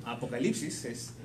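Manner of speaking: ordinary talk, nothing marked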